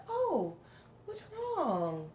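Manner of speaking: high, softened, drawn-out concern; kind and loving. Shown as a demonstration. Vlogger showing how people never ask her, kind and loving. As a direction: demonstrate a tender, caring way of asking. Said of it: "like, a kind and loving way".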